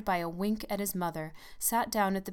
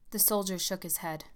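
ordinary delivery, nothing marked

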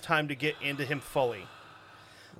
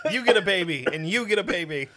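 hammed-up giveaway host; game show giveaway; 2 semitones higher, 4 dB louder than usual. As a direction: announce it like handing out prizes.

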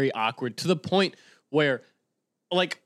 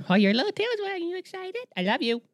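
very high-pitched cooing. Real, not acted. Owner talking to wagging puppy Olive, high baby talk. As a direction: coo excitedly at a little dog.

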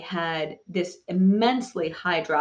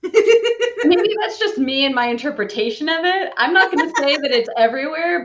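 higher, louder, talking through laughter; laughing; 9 semitones higher, 6 dB louder than usual. Real, not acted. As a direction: laugh your way through the reply. Laughs at host's sarcasm remark.

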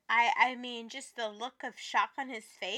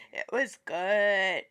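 slow low savoring; 4 semitones lower, 3 dB louder, much slower than usual. Real, not acted. Savoring the memory.

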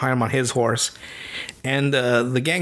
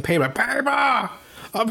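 badly imitated cartoon villain, pitched up; creeper's cry; 8 semitones higher than usual. Creeper keeps saying one word, mock monster cry. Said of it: "I can't even do the voice right".

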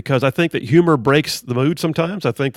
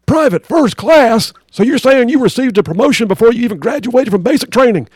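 drill sergeant; 9 semitones higher, 5 dB louder than usual. Acted. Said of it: "drill sergeant".

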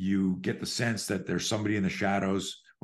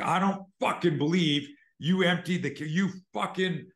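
much higher, ranting fury at an absent partner; acted rage; 8 semitones higher than usual. Faking rage at the ATM to deter a mugger: angry rant. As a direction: go full Hulk and rant. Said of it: "from Bruce Banner to the Incredible Hulk".

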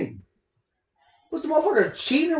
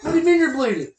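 mock call dialogue; 2 semitones lower, 7 dB louder than usual. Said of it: "She was like".

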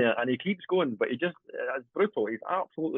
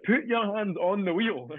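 higher-pitched, urgent imitation of an order; retold urgent order; 7 semitones higher, slower than usual. Passenger grabbed in a skidding car, retold as an urgent high order. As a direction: call out a mock-alarmed command.

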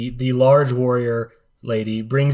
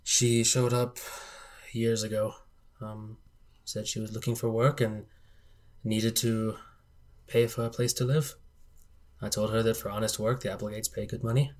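put-on soft, halting old employer; subdued elderly gentleman reminiscing; grieving old patriarch; 9 dB quieter than usual. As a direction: recount it quietly, with long pauses.

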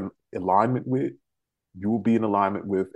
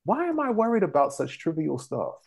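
inner voice; 7 semitones higher than usual. Retold or imitated. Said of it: "and I'm just like".

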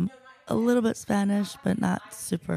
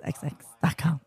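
whisper. Real, not acted. Whispered repeat.

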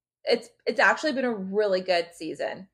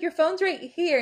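higher-pitched quoted voice; imitated retort; 8 semitones higher than usual. Podcaster imitates crewmate over the found phone, high mock voice.

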